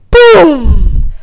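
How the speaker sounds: huge, loud "boom" falling in pitch; explosive, drawn-out imitated boom; booming ice noise. A narrator's reading. Narrator voices the ice booming.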